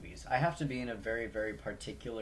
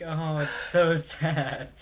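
mocking sob through laughter; 6 semitones higher, 10 dB louder, slower than usual. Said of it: "I'm just like".

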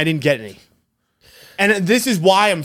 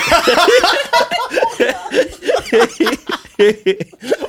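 long, high burst of laughter; big laugh; 11 semitones higher, 4 dB louder than usual. Real, not acted. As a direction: crack up at the silly moment. After the YMCA bit.

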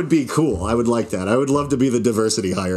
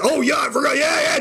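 much higher, rowdy hollering egging him on; hammed-up drunk cheerleader voice; drunk cheering; 14 semitones higher than usual. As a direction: whoop him on like a tipsy heckler at the back.

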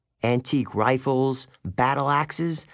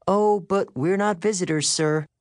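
higher-pitched, polite protest; confident schoolgirl Thalia; 7 semitones higher than usual. A narrator's reading. Thalia bluffs the male teacher, politely insistent.

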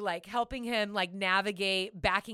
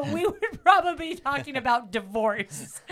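laughing through the words, higher and louder; 5 semitones higher, 7 dB louder than usual. Retold or imitated. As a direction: giggle out the joke about divorce. Joking about fighting, laughing.

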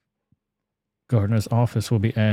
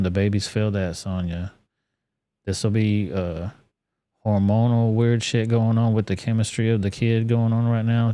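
usual speaking voice

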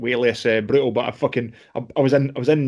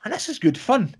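excited; 10 semitones higher than usual. Retold or imitated. Retold first jiu-jitsu session.

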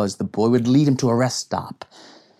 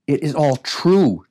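slow, higher insistent protest; 6 semitones higher, 5 dB louder than usual. A narrator's reading. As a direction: plead the point, drawn out. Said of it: "Jesse protested".

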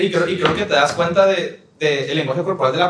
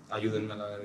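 muttered plea; 8 semitones lower, 16 dB quieter than usual. Retold or imitated. Friend's plea during hug.

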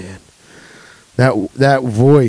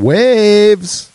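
proud reveal; 9 semitones higher than usual. Pretend.

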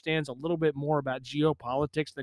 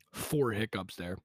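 normal talking voice

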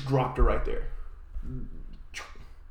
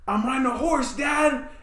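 put-on excited little girl; 10 semitones higher than usual. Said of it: "she goes".

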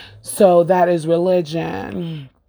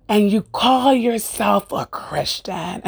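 higher, slow mocking imitation of the neighbor; mock yelled accusation; 5 semitones higher, slower than usual. Retelling how the neighbor yelled at her, hammed-up scornful voice. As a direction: put on a scornful neighbor's voice, drawn out. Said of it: "she yelled".

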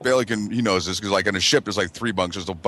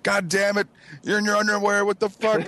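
high, strained imitation of outrage; mock angry bunkmate; 10 semitones higher than usual. Voices the man he landed on.

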